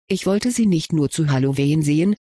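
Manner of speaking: ordinary delivery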